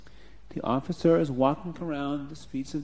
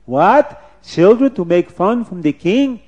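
loud, raised incredulous question; shocked king; 6 semitones higher, 10 dB louder than usual. Acted. King hearing children mock him, loud disbelief. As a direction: react in royal outrage.

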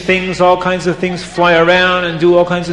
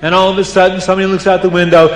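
ordinary delivery

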